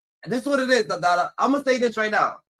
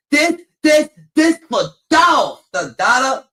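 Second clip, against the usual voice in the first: loud, high, slow furious yell; angry shout; 6 semitones higher, 8 dB louder, slower than usual. Retold or imitated. Dad yelling at his kid during a whipping, furious shout. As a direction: bellow each word while swinging the belt.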